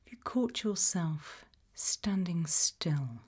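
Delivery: usual speaking voice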